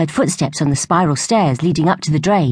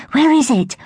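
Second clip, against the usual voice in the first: eager question, pitched higher; 10 semitones higher than usual. A narrator's reading. Evie eager to hear of the hazel grove. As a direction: lean in, eager and much higher. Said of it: "asked Evie eagerly".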